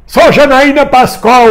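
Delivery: high, stretched-out rallying cry; imitated street shout. Man recalling his yells on Avenida Paulista, mock shouting. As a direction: call it high and slow over a crowd. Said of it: "Eu gritei muito na Avenida Paulista".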